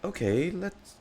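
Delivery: drawn-out suggestion. Pretend. Player echoing game line.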